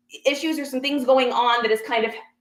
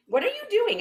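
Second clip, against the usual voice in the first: much higher, incredulous retold challenge; high-pitched disbelief; 9 semitones higher than usual. Shopper pulling back from bag grabber, incredulous. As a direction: pitch the question right up in protest.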